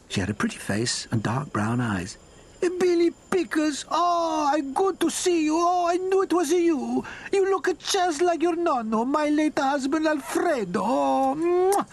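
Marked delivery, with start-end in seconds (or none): 2.6-11.9 put-on very high joyful old lady voice; excited Italian grandmother. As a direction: greet him like a doting nonna.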